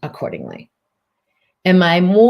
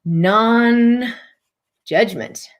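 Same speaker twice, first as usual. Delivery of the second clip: very slow, higher, stretched out; drawn-out emphasis; 4 semitones higher, much slower than usual. Shown as a demonstration. Teacher spelling out the key word, very slowly. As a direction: lean into each syllable slowly. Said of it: "We'll write that on the thing as well".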